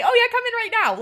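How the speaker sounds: high, loud breezy imitation; mocking receptionist. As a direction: mimic an over-casual receptionist. Mimicking staff who forgot to schedule.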